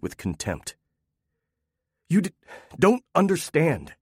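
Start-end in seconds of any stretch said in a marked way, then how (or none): 2.1-4.0 stumbling start, higher pitch; husband faltering before his wife; stuttered. Acted. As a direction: lose the rage mid-word. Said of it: "he stuttered".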